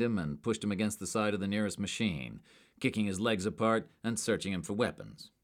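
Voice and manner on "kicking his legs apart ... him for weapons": usual speaking voice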